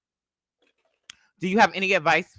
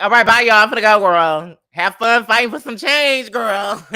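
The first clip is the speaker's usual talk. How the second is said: loud, high sassy goodbye; sassy farewell; 6 semitones higher, 9 dB louder than usual. Retold or imitated. Bartender telling the protesters bye, loud and sassy. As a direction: sing out a cheeky goodbye. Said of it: "So I'm like".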